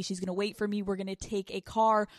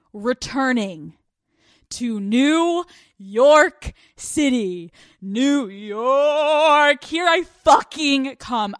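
triumphant announcement; 2 semitones higher, 8 dB louder, slower than usual. Real, not acted. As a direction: proclaim it like a grand arrival. Announcing her return to New York.

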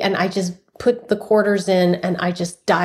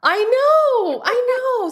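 high, drawn-out delighted agreement; real excitement; slower than usual. Real, not acted. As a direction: agree emphatically, pitched way up. Delighted agreement with the other speaker.

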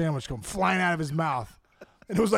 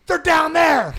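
high, loud mock-kid holler; excited kid's shout; 11 semitones higher, 6 dB louder, much slower than usual. Kid shouts where stars are.

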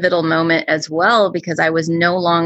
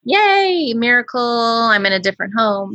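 mock cheer; 6 semitones higher than usual. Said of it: "that doesn't mean, like".

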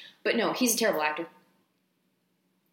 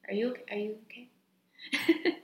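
mock concern; 8 dB quieter, much slower than usual.

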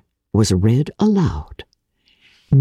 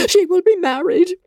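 laughing through the words, high; overjoyed mother laughing at the news; delighted laugh. A narrator's reading. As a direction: burst out laughing with joy.

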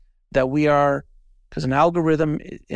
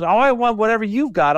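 high, louder eager plea; eager envy; 8 semitones higher, 5 dB louder than usual. Retold or imitated. Friend pleads with fit classmate, eager. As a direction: beg with mock envy.

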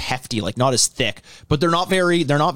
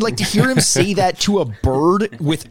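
ordinary delivery

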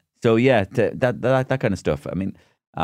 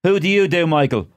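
high, raised presenter voice; radio phone-in host; 10 semitones higher, 5 dB louder than usual. Retold or imitated. Host invites callers to do impressions, high and insistent. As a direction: call out to listeners in a pitched-up host voice.

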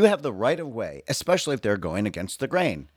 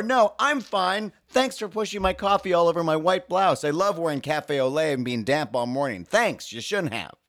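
sarcastic; 7 semitones higher than usual. Retold or imitated. Pedestrian thanking the cyclist for spilled coffee, sarcastic.

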